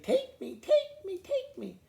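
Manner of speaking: high, loud, pleading little voices; eager little eggs. Acted. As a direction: beg eagerly to be picked.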